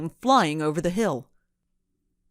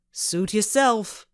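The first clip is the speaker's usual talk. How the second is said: angry; 4 semitones higher, slower than usual. A narrator's reading. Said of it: "he said angrily".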